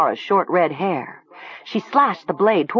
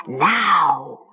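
stretched, insistent order; commanding voice. A narrator's reading. The voice repeats its order to Talia, commanding. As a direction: draw the word out insistently. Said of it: "commanded the voice".

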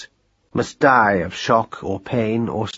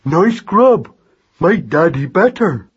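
loud simple lad talking through food; munching boy, high and hearty; mouth full; 4 semitones higher, 4 dB louder, slower than usual. A narrator's reading. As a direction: say it slowly round a mouthful. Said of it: "said Magnus with his mouth full".

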